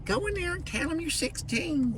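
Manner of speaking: put-on high, soft old lady voice; a sweet grandma, high and hushed. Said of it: "and grandma voice go".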